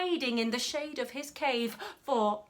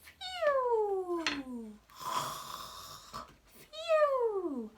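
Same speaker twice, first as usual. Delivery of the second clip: sleepy snoring breath; 6 semitones higher, 4 dB quieter than usual. Acted. Elephant snoring in her sleep.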